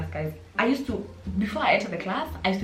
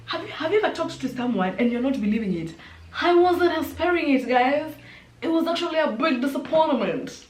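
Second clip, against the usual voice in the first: 1 shocked disbelief; 7 semitones higher than usual. Real, not acted. Shocked retelling, raised pitch and level.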